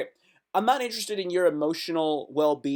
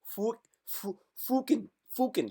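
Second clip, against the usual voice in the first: Irish-ish fucking; 4 semitones higher, 5 dB quieter than usual. Retold or imitated. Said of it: "like an Irish person".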